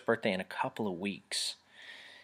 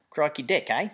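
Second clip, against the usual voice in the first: put-on a playful, slightly higher stab at an Australian accent, done as a joke; mock Australian accent; 5 semitones higher, 4 dB louder than usual. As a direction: toss off a cheesy Australian accent for fun. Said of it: "I speak Australian".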